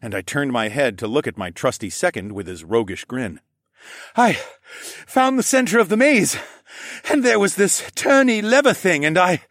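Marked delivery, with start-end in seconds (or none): 4.1-9.4 high, loud breathless excitement; worked-up, amazed exclamation; exclaiming. Acted. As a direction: marvel at a friend, tripping over the explanation. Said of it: "he exclaimed".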